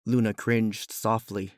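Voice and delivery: plain reading voice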